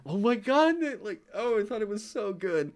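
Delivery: high-pitched retold gush of delight. Streamer recalling reaction to their own joke, high gushing delight.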